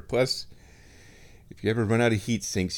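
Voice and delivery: normal talking voice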